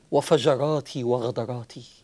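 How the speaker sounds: low, slow, ashamed question; shamed sinner. Retold or imitated. Sinner asking the Prophet about his sins, low and ashamed. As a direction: hang the head over the words. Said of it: "فقال الرجل".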